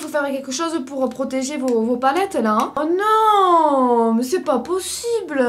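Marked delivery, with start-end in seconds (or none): 2.8-5.4 cri de dépit aigu et fort; oh non, la catastrophe; consternée. Real, not acted. Colis abîmé, palettes cassées. (consternation dramatique)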